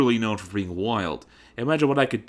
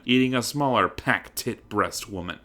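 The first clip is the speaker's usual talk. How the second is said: plain reading voice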